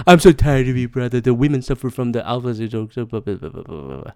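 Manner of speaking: low droning recital trailing into blahs; dismissive mock quote. Host mocks people's replies, low dismissive drone. Mocking people's online responses.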